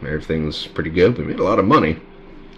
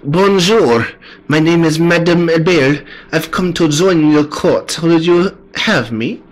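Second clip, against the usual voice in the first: high, hammed-up French madame; French accent; 11 semitones higher, 5 dB louder, slower than usual. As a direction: introduce yourself in a shaky attempt at French accent. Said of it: "attempt to do a French accent".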